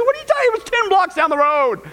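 high-pitched incredulous protest; exasperated husband. Acted. Husband protesting wife's false alarm, high exasperation. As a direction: start very high, settle into exasperation.

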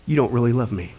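ordinary talk

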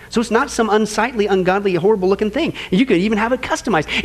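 normal talking voice

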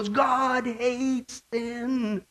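high, very slow intoned proclamation; drawn-out slogan. Acted. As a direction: stretch each word out long, pitched high. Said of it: "it should go".

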